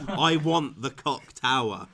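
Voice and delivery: slow, loud, laughing through it; laughing insistence. Retold or imitated. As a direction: drag it out while laughing.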